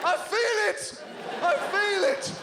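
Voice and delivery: mock preaching. Comedian preaching to the New York crowd, mock sermon. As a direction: testify like a tent preacher. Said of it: "It turns out preaching is exactly as easy".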